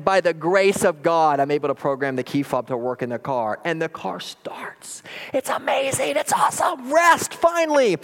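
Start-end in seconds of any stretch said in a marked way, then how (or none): 3.6-5.0 hushed, slow whisper; awed whisper. Retold or imitated. Camper tells of the reprogrammed key working, slow awed whisper. Suspense before the car works. 5.3-8.0 high-pitched mock celebration, slowing on the last words; elated outburst. Weary camper celebrates the car starting, hammed-up elation.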